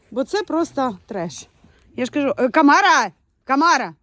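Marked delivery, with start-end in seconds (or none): 2.5-4.0 loud call across the yard; yelling for the dog. Real, not acted. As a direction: shout the dog's name to call her back.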